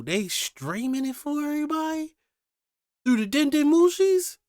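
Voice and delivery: high, loud outraged disbelief. Real, not acted. Reacting to killings streamed to everyone, outraged and shocked.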